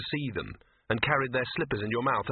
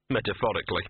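plain reading voice

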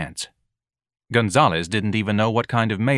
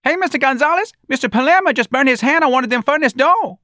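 much higher, loud panicked worker yell; frantic factory worker shouting news; worker screaming; 6 dB louder than usual. Acted. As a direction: scream it across the factory floor. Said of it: "one of the workers screamed".

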